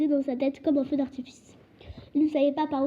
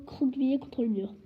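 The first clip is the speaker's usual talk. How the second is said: plain reading voice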